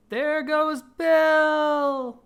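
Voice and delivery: high, very slow, loud crowd cry; chorus shout. Acted. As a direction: everyone yelling at once. Crowd cries as Bill flies.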